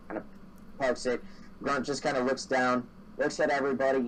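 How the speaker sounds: ordinary delivery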